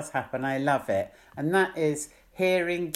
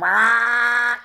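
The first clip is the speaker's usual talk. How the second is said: high, loud crow caw; 7 semitones higher, 6 dB louder than usual. Retold or imitated. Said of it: "'Cause they go".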